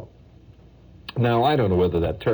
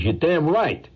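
higher-pitched touchy agreement; touchy retort; 8 semitones higher, slower than usual. Acted. Painter answering praise of his turtle, touchy retort. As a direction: agree as if a nerve was hit. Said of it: "this sore tooth like that".

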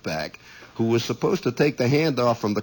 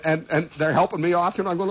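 ordinary delivery, nothing marked

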